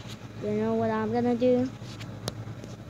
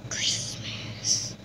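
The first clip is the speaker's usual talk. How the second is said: softly whispered word; 10 dB quieter than usual. Real, not acted. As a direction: breathe the word, no voice. Whispered answer in game.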